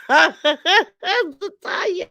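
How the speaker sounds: laughing name. Real, not acted. Host laughing at a viewer's comment, calls her name through a laugh. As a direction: laugh warmly while calling Zatanya, drawn out.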